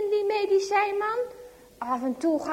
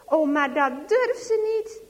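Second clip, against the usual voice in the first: ordinary delivery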